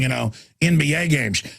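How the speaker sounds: ordinary delivery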